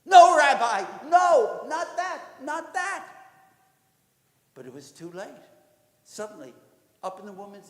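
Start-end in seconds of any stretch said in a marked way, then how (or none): 0.0-3.0 loud, high cries of protest; frantic crowd outcry; panicked pleading. Acted. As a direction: shout it in horror, begging him to stop. Congregation horrified at the announcement.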